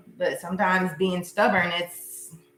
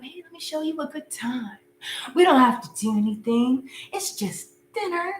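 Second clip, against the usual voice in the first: smooth-talking ex; 5 semitones higher than usual. Pretend. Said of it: "pop back up".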